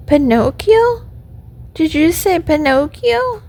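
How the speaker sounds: high, questioning puppet voice; acted startled puppet; surprised puppet. A narrator's reading. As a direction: ask it in high-pitched astonishment. Puppet startled to hear his name.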